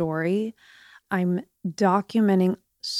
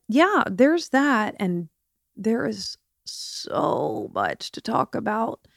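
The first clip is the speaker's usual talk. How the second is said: usual speaking voice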